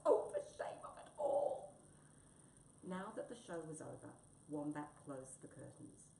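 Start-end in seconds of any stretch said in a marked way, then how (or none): 0.1-1.7 high, loud wail of shame; outraged, humiliated cat; indignant lament. Acted. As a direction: wail it melodramatically. Humiliated cat laments after show.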